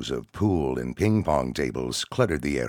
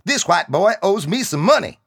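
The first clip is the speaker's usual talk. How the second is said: angry shout; 9 dB louder than usual. Acted. Soldier rallying comrades.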